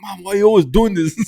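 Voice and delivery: high, loud mock protest; imitating an exasperated outburst; flipping it on Mom. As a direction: turn the blame on Mom, voice jumping up. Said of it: "And I flipped it on her".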